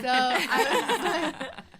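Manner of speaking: laughing out the words; loud burst of laughter while talking. Real, not acted. Laughing while telling the story.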